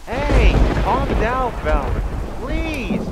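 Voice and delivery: slow, loud "hey" cutting in; begging. Acted. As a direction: plead over someone's panic. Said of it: "begged Fred".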